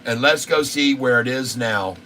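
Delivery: usual speaking voice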